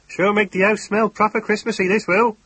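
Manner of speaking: high, loud rustic farmer chuckling; a jolly farmer pleased with his cigar; chuckling country farmer. Acted. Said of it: "he chuckled".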